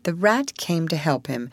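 plain reading voice

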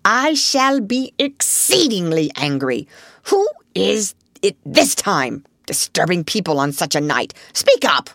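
gruff and suspicious. A narrator's reading. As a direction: scold unknown late callers suspiciously. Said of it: "said a gruff and suspicious voice".

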